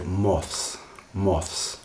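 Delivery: "moths" with a clear th before the s; careful pronunciation. Shown as a demonstration. Teacher showing learners the careful form: clear moths. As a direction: say "moths" slowly, every sound distinct. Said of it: "I'm pronouncing it very carefully there".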